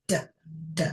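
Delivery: short, separate "D, d," as the bare sound duh; isolated d sound. Shown as a demonstration. Teacher sounding out dog's first sound for pupils, isolated "D, d,". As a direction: say "D, d," as two crisp duh beats, paused between.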